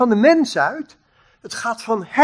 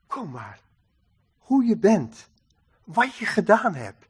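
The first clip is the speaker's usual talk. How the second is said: tender acceptance; 6 dB quieter than usual. Acted. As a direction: welcome them quietly, leaving space between phrases. God accepts people as they are. (zacht, teder en aanvaardend)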